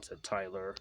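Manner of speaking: usual speaking voice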